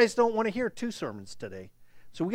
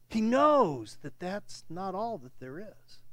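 ordinary delivery

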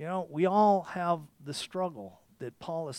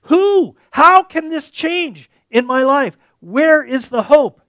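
high, loud, slow anguished cry; 9 semitones higher, 17 dB louder, slower than usual. Retold or imitated. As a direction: plead out loud to the heavens, slowly. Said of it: "And Paul is crying out".

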